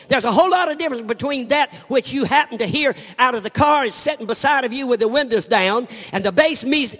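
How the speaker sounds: loud, high, driving sermon voice; full-force preaching. Acted. Preacher thundering at his congregation, loud and high. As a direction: preach it hard to the crowd.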